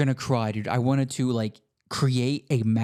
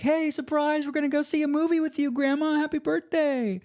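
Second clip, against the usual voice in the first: much higher, excited family cheering surprise; surprise shout. Retold or imitated. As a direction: squeal it like a crowd springing a surprise. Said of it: "And then, like".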